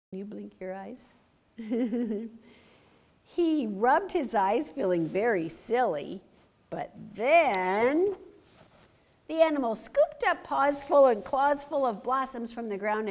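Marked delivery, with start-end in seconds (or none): none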